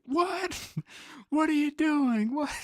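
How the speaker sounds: put-on very high, loud, outraged old Italian man. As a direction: squawk it like an indignant old Italian grandpa. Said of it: "imagine an Italian old man being like".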